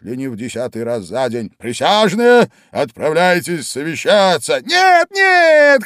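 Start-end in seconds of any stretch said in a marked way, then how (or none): 1.6-4.4 high, loud king's order; king calling the jury out; loud royal command. A narrator's reading. 4.6-5.8 very high, loud shriek; queen shrieking over the king. A narrator's reading. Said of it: "крикнула королева".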